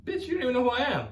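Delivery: mock diva comeback; sassy comeback. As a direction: snap it back with sass. Sassy comeback to yoga teacher.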